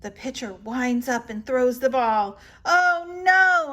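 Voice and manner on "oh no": loud, high cry of dismay; yelling. A narrator's reading. Girl watching her player miss, loud high yell. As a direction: yell it out in alarm. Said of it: "I yell".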